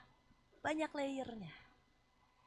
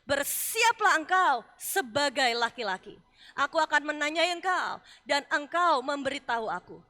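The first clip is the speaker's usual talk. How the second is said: God's booming challenge; 10 dB louder than usual. Acted. God speaking to Job, preached.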